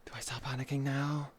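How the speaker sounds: breathy whispered question; soft unvoiced worry. Real, not acted. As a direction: whisper it like a secret. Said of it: "whispered question".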